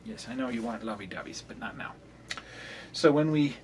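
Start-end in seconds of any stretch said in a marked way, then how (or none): 0.1-1.9 soft, quick pet talk; cooing to the cat. Real, not acted. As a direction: coo gently at a cat. Soothing the cat on the desk.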